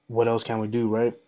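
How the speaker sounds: usual speaking voice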